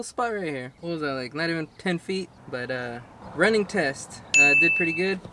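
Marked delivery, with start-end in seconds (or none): none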